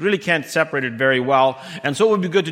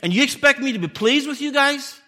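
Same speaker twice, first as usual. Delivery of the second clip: God's indignant question, pitched higher; 8 semitones higher than usual. Retold or imitated. God asking Judah whether He should be pleased, indignant. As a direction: let God's disbelief rise through the question. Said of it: "He says".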